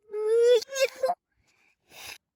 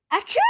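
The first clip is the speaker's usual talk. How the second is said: a short sharp mock sneeze; acted sneeze; 4 semitones higher than usual. Gerald the elephant, sneezing in a quick mock burst. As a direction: burst out a cartoon achoo.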